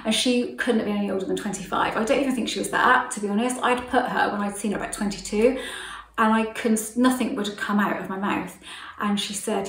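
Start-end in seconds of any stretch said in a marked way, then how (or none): none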